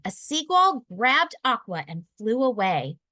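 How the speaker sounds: ordinary delivery, nothing marked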